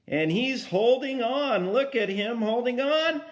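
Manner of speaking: ordinary delivery, nothing marked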